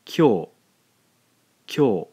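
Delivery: one quick beat, "kyo"; "kyo" pronounced as a single demo syllable; blended kyo. Shown as a demonstration. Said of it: "When we blend these two syllables together".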